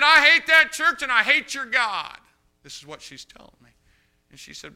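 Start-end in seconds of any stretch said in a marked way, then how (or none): 0.0-2.2 high, loud and slow; slow furious shout. Retold or imitated. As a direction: spit each word in rage. Drunk father raging.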